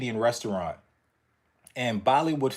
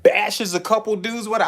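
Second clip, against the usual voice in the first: normal talking voice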